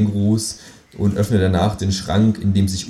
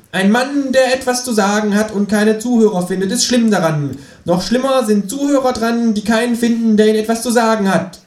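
much higher, louder grand declaiming kangaroo; booming kangaroo proclamation; 14 semitones higher, 4 dB louder than usual. Acted. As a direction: proclaim it from the table like an orator. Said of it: "springt auf den Tisch und tönt".

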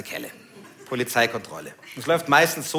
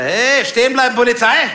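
mock police shout; 7 semitones higher, 6 dB louder than usual. Imitating police calling out.

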